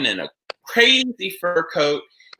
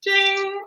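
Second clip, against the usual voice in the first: mock ding. Podcast sponsor sound cue.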